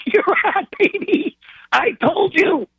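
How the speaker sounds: very high, triumphant crowing; gleeful gloating. Retold or imitated. Husband to wife after the general answers, triumphant.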